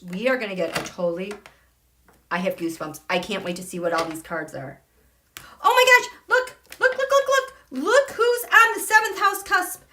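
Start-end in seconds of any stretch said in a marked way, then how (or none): 5.6-9.8 high excited squeal of discovery; thrilled. Real, not acted. Astrologer spotting someone on the seventh house cusp, thrilled. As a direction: urge everyone to look, high and breathlessly thrilled.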